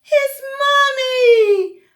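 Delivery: high, loud, slow building call to mommy; joyful rush. A narrator's reading. Douglas running to his mommy, building to a loud high cry. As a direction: swell with joy as he runs.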